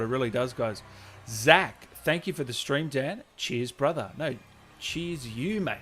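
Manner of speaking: ordinary delivery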